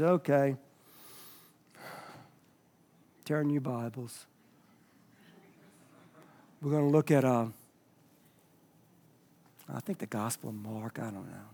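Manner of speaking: low, very soft, trailing off; listless, uncaring. Shown as a demonstration. Pastor demonstrates opening a service without enthusiasm, listless. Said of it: "this guy doesn't care too much".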